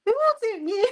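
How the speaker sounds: high-pitched fussing over something lost; playful, very high-pitched fretting; mock panic. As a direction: squeal it in pretend alarm. Mock fuss over lost tool.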